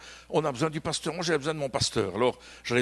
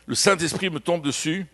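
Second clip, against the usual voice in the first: ordinary delivery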